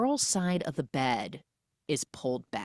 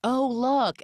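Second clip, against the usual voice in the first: landlady noticing; 9 semitones higher, 4 dB louder than usual. Retold or imitated. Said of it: "the landlady is like".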